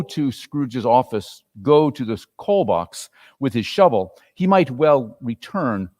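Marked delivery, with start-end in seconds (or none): none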